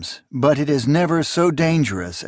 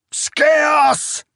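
much higher and louder shout of the traitor's name; anguished cry; 4 dB louder than usual. A narrator's reading. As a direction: hurl the name up at the ceiling. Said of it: "Zarias cried to the engraved ceilings".

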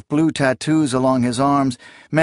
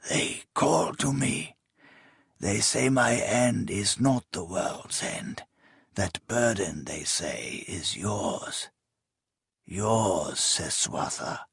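faint dying voice; 2 semitones lower, 8 dB quieter, slower than usual. Acted. Dying king's faint last words.

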